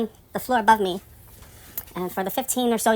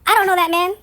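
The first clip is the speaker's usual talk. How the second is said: insistent; 10 semitones higher, 5 dB louder than usual. Retold or imitated. Retold insistence, raised voice.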